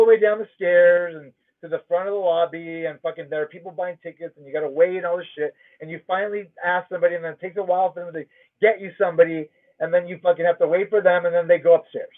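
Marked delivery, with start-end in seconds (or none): none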